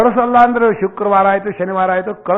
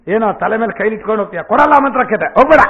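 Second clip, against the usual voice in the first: stern refusal; 5 semitones higher, 5 dB louder, faster than usual. Retold or imitated. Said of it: "ಅಂದ್ಬಿಟ್ರು".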